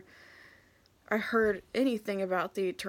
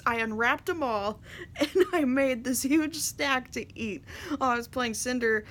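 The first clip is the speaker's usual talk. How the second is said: normal talking voice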